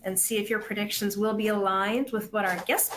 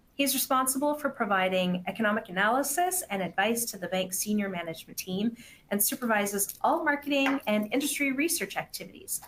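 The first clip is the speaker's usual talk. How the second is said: normal talking voice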